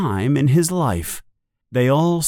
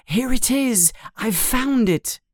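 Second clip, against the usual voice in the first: high, thrilled discovery; excited; 12 semitones higher than usual. A narrator's reading. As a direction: burst out with delight. Harry suddenly finds the mirror.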